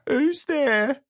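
high, squeaky, sad little call; a small unseen voice calling through the door. Acted. Mister Miserable answers the knock, sad and squeaky. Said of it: "a sad, squeaky sort of voice".

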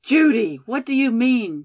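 startled, urgent questioning; alarmed cry. A narrator's reading. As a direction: cry out for an explanation. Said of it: "cried Molly".

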